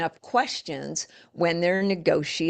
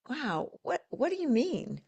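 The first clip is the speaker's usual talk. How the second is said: higher and softer, genuinely curious; 7 semitones higher, 4 dB quieter than usual. Shown as a demonstration. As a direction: ask it with real wonder.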